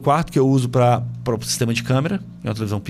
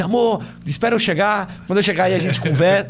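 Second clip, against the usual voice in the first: wife's voice; 8 semitones higher than usual. Retold or imitated. Husband voices wife on phone.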